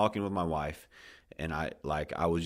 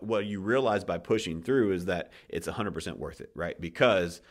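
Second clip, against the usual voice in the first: ordinary talk, nothing marked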